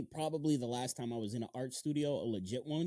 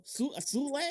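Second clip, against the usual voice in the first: mock upscale "Sule?", pitched much higher; posh pronunciation; 11 semitones higher than usual. Shown as a demonstration. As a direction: say "Sule" like a fancy high-class name. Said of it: "if you wanna be, like, super high class".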